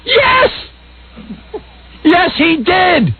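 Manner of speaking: eager witness rising in pitch; hammed-up eager witness; mock testimony. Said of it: "you be Marsha Clark".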